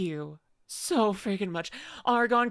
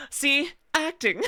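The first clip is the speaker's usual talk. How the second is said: acting sane; 7 semitones higher, 3 dB louder, slower than usual. Shown as a demonstration. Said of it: "I am acting".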